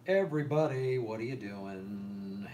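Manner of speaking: normal talking voice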